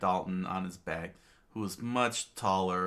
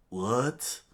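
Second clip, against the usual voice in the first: slightly raised, higher question; Wapol's mild yell; 4 semitones higher, 3 dB louder than usual. Acted. King reacting to Luffy's insult, mild mock yell. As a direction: blurt it with mild outrage. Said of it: "screamed out".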